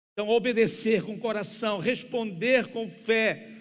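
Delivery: loud, high, fervent sermon delivery; full-force preaching. Real, not acted. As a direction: preach it hard in driving, urgent phrases.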